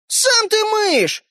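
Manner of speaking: indignant toy man in a tiny high voice; thin little voice. A narrator's reading. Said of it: "раздался вдруг тоненький голос".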